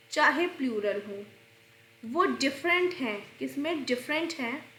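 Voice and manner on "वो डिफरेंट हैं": usual speaking voice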